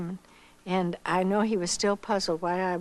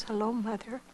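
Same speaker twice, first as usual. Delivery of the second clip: choked up; 6 dB quieter than usual. Real, not acted. First call home in years.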